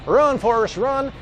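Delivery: high-pitched mock urging, Forrest Gump style; movie-quote call. Host warns viewers off carrageenan, high playful call to flee.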